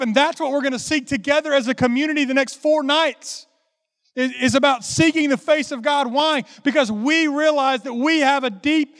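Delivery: high, driving revival-preacher cadence; full-force preaching. Acted. Preacher calling congregation to seek God's face, full-force preaching. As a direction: build to a loud, insistent call, slowing at the end.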